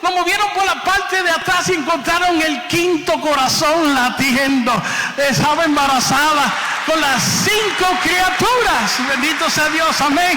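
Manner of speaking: high, loud shouted praise; jubilant shouting. Real, not acted. Preacher announces the quintuplets to his listeners in a jubilant shout. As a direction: cry it out in triumph, slowing on the reveal.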